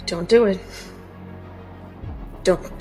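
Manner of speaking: normal talking voice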